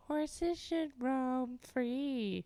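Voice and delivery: slow, drawn-out mock quote. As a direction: stretch it out, poking fun. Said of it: "They always go".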